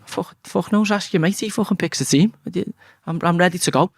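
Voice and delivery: high, exasperated cheeky reply; cheeky retort. Retold or imitated. Young player answering the assistant manager, exasperated and high. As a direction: toss it back with frustrated cheek. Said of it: "And I'd say".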